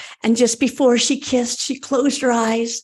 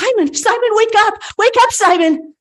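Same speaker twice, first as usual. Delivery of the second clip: higher and louder, calling him awake; pleading call; 10 semitones higher, 6 dB louder than usual. Acted. Margaret calling to lifeless Simon, loud and pleading.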